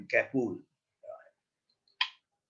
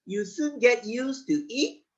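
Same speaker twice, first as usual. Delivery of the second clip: wicked frog; 7 semitones higher than usual. A narrator's reading. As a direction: tease the rat like a cruel frog. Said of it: "says the wicked frog".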